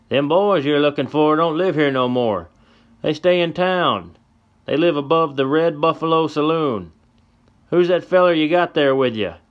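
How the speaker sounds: put-on gruff old codger telling; old man voice.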